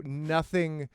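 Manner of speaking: ordinary delivery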